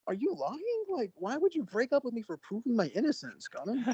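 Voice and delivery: high-pitched, disbelieving retold thought; acted incredulity. Boyfriend questions threat over proving innocence, mock incredulous. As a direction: start very high, ease down in disbelief.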